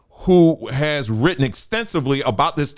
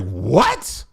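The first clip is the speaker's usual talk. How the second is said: incredulous; 4 semitones lower than usual. Acted. Speaker reacting to people claiming to outrank Bible figures, incredulous. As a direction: react in disbelief with a steep rising question.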